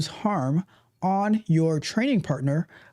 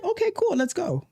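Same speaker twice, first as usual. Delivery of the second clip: breezy okay; 12 semitones higher than usual. Retold or imitated. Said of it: "So I'm like".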